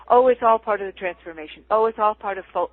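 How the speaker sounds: loud, breezy dismissive tone; blithe. Retold or imitated. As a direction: toss it off without a care. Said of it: "You don't just, uh, blithely".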